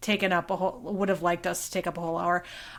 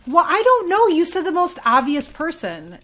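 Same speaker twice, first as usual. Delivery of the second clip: defensive protest; 8 semitones higher, 6 dB louder than usual. Real, not acted. Defending wrong guess.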